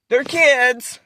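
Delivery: high, held-up mock protest; mimicked excuse. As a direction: parrot their excuse, stretching "kids" up high. Mimicking the other parents' excuse.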